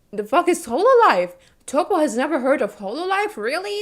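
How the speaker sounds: high, loud incredulous outburst; loud disbelief. Real, not acted. Streamer stunned by a friend's ignorance of Hololive, incredulous. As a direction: blurt it out, astonished, voice shooting up.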